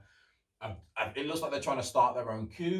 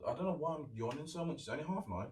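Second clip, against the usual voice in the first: normal talking voice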